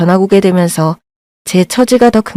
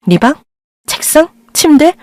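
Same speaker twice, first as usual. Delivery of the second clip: mocking echo; 7 semitones higher than usual. Mother mocking daughter's wants.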